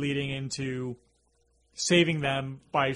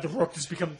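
ordinary talk

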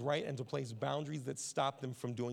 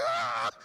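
put-on kid yell; 5 semitones lower, 9 dB louder than usual. Son yells at sister.

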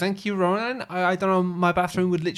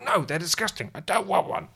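mock petulant refusal; 5 semitones higher than usual. Voices wife refusing the sweet.

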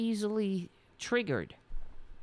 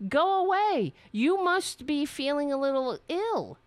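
high and loud mock voice, sneering; mock shrill owner; 7 semitones higher, 4 dB louder than usual. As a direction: shout it as the snooty owner. Mocking the business owner.

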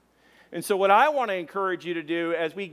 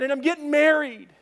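high, loud happy news; imitating an elated friend; excited announcement; 10 semitones higher, 5 dB louder than usual. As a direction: share big news with a friend, pitched up.

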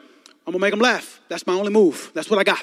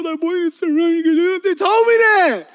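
put-on higher, louder whiny pleading boy; pleading kid; 9 semitones higher, 7 dB louder, slower than usual. Son begging his dad for a haircut, whiny. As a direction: beg dad like an upset kid.

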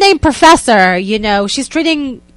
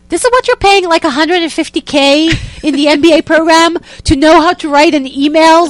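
mock outrage; 6 semitones higher, 4 dB louder than usual. Mocking outrage at MBA program.

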